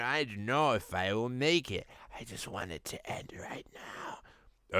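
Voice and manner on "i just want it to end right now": breathy whisper. Acted. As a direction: whisper it hopelessly. Goku despairing.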